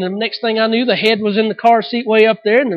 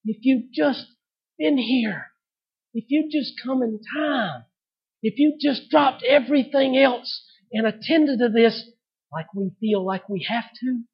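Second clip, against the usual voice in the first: grieving plea; 3 semitones higher, 5 dB quieter than usual. Retold or imitated. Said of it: "Lazarus' sister said".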